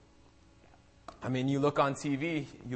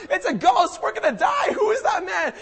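high, hammed-up panic; freaking out; 7 dB louder than usual. Disciples in the raging storm seeing a figure, mock panic. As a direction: cry it out in mock terror. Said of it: "they're freaking out".

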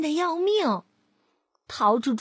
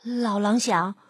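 ordinary delivery, nothing marked

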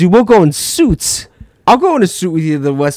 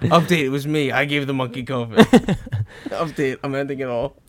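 deadpan confession; 7 dB quieter than usual. Acted. Mock TikTok post voiced.

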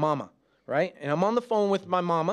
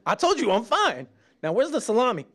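high, loud, laughing annoyance; irritated snap; 7 semitones higher, 3 dB louder than usual. Pretend. Husband snaps at his wife while hunting salami, mock irritated. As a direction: bite back at her concern.

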